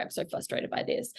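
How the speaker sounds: breathy whisper of frustration. Retold or imitated. Tarot reader whispering a client's frustration. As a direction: whisper it like a fed-up sigh.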